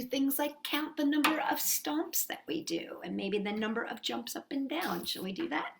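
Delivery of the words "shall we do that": plain reading voice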